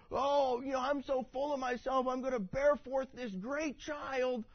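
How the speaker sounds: high, hammed-up pompous straining; boastful man in labor. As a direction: ham up a full-of-himself man pushing.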